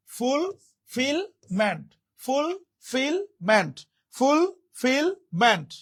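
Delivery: Fulfilment slowly split into three separate parts; syllable by syllable. Shown as a demonstration. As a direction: sound out fulfilment slowly, pausing between each part. Teacher sounds out word for spelling.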